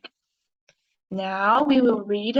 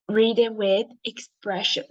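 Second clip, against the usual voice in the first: usual speaking voice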